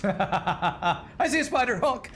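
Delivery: high giggly squeaky voice; silly clown. Pretend. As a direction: squeal it through a laugh. Voicing train at spiders.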